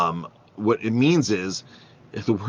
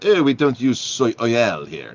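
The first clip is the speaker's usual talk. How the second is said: slow, louder mock French waiter; French accent; 4 semitones higher, 5 dB louder, slower than usual. French waiter reassuring a diner about soy oil, French accent. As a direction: draw it out in a thick French accent. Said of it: "So the waiter goes in this French accent".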